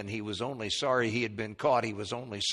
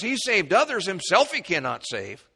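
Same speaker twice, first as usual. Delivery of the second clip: mocking priests; 7 semitones higher, 4 dB louder than usual. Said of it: "chief priests mocking said".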